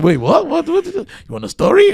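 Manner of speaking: high, loud baffled questions; rising disbelief at each what; confused disbelief. Retold or imitated. Confused newcomer hearing Irish slang.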